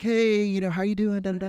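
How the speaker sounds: high, singsong friendly greeting; high-pitched imitation of a cheery caller; imitated friendly check-in.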